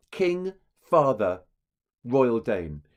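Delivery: "king", "father", "royal Dane" set apart by pauses, slow; pauses at caesuras. Shown as a demonstration. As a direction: break after "king" and after "father", weighting each title. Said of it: "he's pausing".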